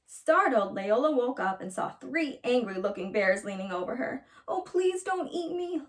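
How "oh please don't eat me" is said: higher, slow scared plea; frightened plea. Acted. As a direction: beg the bears, scared. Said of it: "Leola cried".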